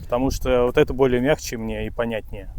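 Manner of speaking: ordinary talk, nothing marked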